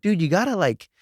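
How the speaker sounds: playfully imitating his scolding wife; mock wife. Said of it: "she's like".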